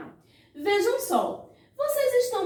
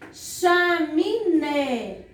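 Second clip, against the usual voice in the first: "chaminé" very slowly, cha-mi-NÉ; syllable by syllable; much slower than usual. Shown as a demonstration. Teacher showing pupils the last syllable, "chaminé" stretched out slowly. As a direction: draw out each syllable of "chaminé". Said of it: "Vejam só".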